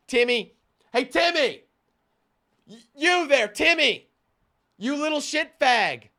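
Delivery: loud, high, hammed-up calling out; mock angry yelling. Someone calling out a kid over a game, mock angry yell. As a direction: holler at a kid as if across the room.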